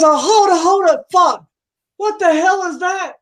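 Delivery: high, loud yelps to stop; panicked protest. Acted. Customer squatted on, yelling in alarmed protest. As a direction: holler for her to stop.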